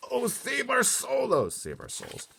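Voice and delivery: slow, high, loud wail; anguished cry. A narrator's reading. Giant laments the nightmare, anguished wail. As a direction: cry to the heavens. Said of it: "he cried".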